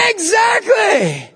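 high, drawn-out, emphatic; high excited shout of agreement; emphatic exclaim. Real, not acted. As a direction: land it with a big punch.

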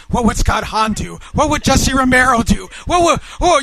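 mock panic. Husband blanks on Bible verses mid-argument, mock panic.

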